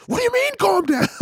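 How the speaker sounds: high-pitched mock outrage; mock flare-up. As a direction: imitate someone losing it, voice shooting way up. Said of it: "It normally flares up even more".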